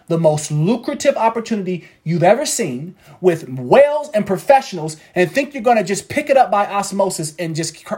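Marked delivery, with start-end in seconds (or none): none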